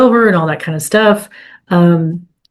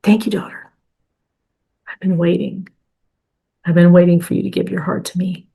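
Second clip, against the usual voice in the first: tender voice of God. Retold or imitated. God the Father answering his daughter, tender hush. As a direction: breathe it out as a loving father. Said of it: "the Father going".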